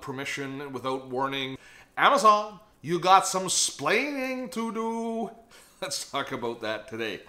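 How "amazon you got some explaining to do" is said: high, loud, drawn-out catchphrase; mock scolding. Host playfully telling off Amazon, mock scolding. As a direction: call them out playfully, stretching the words high.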